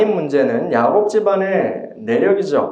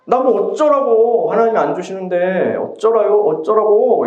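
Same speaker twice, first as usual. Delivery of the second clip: exasperated retort; 6 semitones higher than usual. Retold or imitated. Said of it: "이렇게 하는 거예요".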